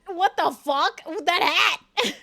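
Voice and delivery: cracking up at the silly hat; laughing disbelief. Real, not acted. Gawking at the leprechaun hat while laughing.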